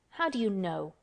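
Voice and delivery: plain reading voice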